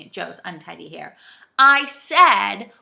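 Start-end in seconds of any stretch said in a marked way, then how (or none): 1.6-2.7 raised, emphatic repeat; loud. A narrator's reading. Joe repeats himself to the saucepan man, loudly. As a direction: say it loudly and firmly, word by word. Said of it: "said Joe loudly".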